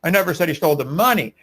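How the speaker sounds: "money" punched at the end; stress on MONEY. Shown as a demonstration. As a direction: save the weight for the final word "money". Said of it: "different emphasis".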